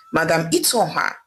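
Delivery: ordinary delivery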